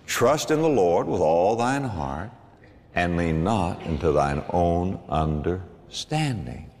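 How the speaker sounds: slow, softening scripture recital; slow solemn reading. Real, not acted. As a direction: read it like a benediction.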